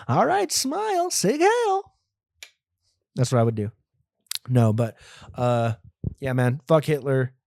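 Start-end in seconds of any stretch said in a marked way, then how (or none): 0.1-1.9 much higher, slow, sing-song photo cue; silly high-pitched picture-taking voice; mock photographer voice. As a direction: ham up a cheesy photographer. Posing guest, mocking photographer.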